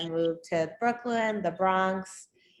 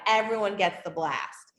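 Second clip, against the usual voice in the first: ordinary delivery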